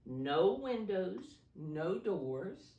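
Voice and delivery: no punched loud and slow; teacher stressing the riddle words. Shown as a demonstration. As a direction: lean hard on each no.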